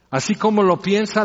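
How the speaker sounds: ordinary delivery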